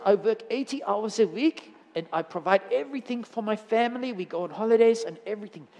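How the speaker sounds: put-on a German businessman boasting, light accent. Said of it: "forgive my German accent".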